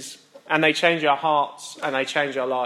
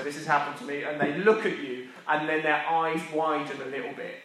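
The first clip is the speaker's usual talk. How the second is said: ordinary delivery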